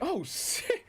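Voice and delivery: high-pitched retold shock; offended shock. Streamer recalling his reaction to the clip, mock shocked. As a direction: reenact being taken aback, pitch jumping up. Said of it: "Even I, I got offended".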